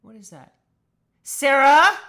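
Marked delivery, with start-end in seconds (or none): 1.2-2.0 calling out. Real, not acted. Calling out to friend.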